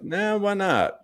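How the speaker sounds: high breezy Nah, why not; carefree shrug. Retold or imitated. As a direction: toss it off casually. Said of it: "Just like".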